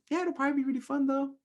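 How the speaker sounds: chirpy carefree reply, very high; cheery brush-off. Retold or imitated. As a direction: breeze past the doubt, sing-song light. Cheery answer to negative self-talk.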